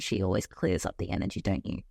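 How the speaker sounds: pet talk. Real, not acted. Asking the cat, a hint of low soft pet talk.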